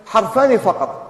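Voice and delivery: usual speaking voice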